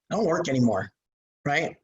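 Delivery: usual speaking voice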